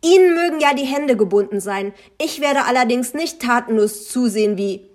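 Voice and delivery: loud, high, heated protest; angry scolding. A narrator's reading. Narrator rebukes Frau Müller for inaction, scolding loudly. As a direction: keep scolding until cut off. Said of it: "keifte ich sie an".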